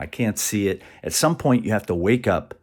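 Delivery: ordinary talk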